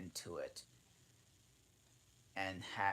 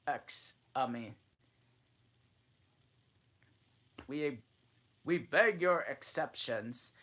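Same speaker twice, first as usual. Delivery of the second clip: higher, louder mock-ceremonial speech; solemn pompous dodo; 6 semitones higher, 7 dB louder than usual. A narrator's reading. Dodo solemnly presents Alice the thimble, mock ceremony.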